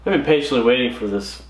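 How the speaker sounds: usual speaking voice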